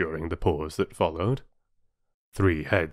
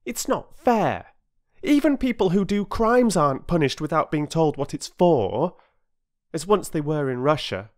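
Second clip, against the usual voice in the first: put-on higher, louder boy protesting unfairness; earnest, aggrieved lad; indignant boy; 10 semitones higher, 5 dB louder than usual. A narrator's reading. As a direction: argue it out with schoolboy indignation. Voices Peter protesting.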